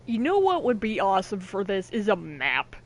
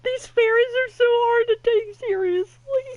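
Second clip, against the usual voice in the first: laughing through the words, high and loud; can't get it out for laughing; real giggling fit; 14 semitones higher, 6 dB louder than usual. Real, not acted. Cracking up at fairy design.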